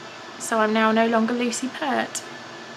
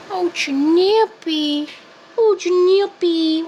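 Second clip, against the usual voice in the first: higher, slow, louder cooing of the name; cooing pet voice; 6 semitones higher, 4 dB louder, much slower than usual. Acted. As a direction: croon the cat's name lovingly. Cooing at cat.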